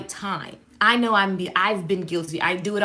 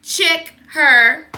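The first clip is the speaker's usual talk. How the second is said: sassy complaint; 2 semitones higher, 6 dB louder, slower than usual. Retold or imitated. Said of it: "walked up to the rock star like".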